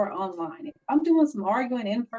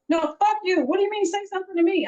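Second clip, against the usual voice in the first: higher, louder, fast indignant comeback; indignant retort; 8 semitones higher, 5 dB louder than usual. Retold or imitated. Woman retelling her angry reply to online trolls, indignant. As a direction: snap back in disbelief.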